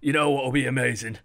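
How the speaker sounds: hammed-up Cockney accent; Cockney exec. Valve exec dreaming up profits, put-on Cockney accent. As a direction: lay on a cheeky Cockney accent as the scheming exec. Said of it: "Why he's Cockney, I don't know".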